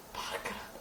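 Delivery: "Párkrát" zašeptané bez hlasu, jako tajemství; whisper. Shown as a demonstration. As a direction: zopakuj "Párkrát" tiše šeptem. (šeptem "Párkrát" jako dovětek)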